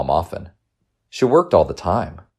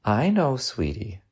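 tender mom voice, higher then hushed; soft motherly sympathy; softly; 7 semitones higher, 5 dB quieter, slower than usual. A narrator's reading. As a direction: soothe gently, fading on sweetie. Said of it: "she said softly".

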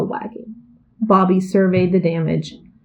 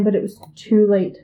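ordinary delivery, nothing marked

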